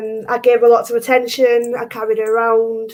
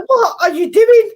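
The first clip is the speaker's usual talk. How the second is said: higher, louder baffled question; baffled at cat; 9 semitones higher, 5 dB louder than usual. Retold or imitated. Owner retelling scolding her scooting cat, higher baffled question. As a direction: ask the cat in disbelief. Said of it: "and I'm like".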